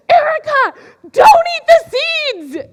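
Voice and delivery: high, loud mock-dramatic cry of warning; hammed-up alarm, belting the name out; super dramatic yell. Older kid warning little Erica off the seeds, dramatic yell. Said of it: "super dramatic".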